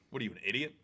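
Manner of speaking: ordinary delivery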